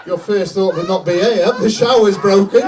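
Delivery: loud mock-indignant punchline; hammed-up loud indignation; exasperated argument. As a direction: build to an exasperated shout. Mock argument with wife, building punchline.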